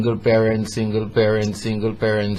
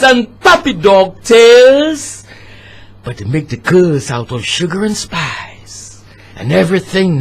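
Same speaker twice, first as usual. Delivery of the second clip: spoken word poem; 12 semitones higher, 9 dB louder than usual. Acted. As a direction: perform it like a poet on stage. Poem narration.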